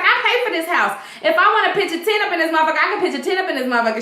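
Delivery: fast, cocky retort; defiant rapid retort. Retold or imitated. Ed defying Esperanza, rattling off fast. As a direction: rattle it off like you own the place. Ed defiant about paying for house.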